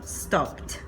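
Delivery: stopped as "stopt"; one-syllable stopped with a released T; final T sound. Shown as a demonstration. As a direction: end stopped on "pt", no extra vowel. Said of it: "voy a pronunciarlo".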